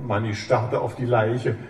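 ordinary delivery

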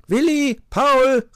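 loud, very high shout to friends; calling out. A narrator's reading. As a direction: call the names out excitedly. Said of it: "rief Wuschel".

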